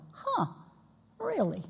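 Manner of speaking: high, soft mock surprise; a quiet, high-pitched double take; puzzled reaction. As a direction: imitate polite disbelief, quietly. Acts puzzled reaction to visitors.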